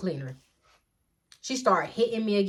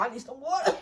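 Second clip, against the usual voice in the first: usual speaking voice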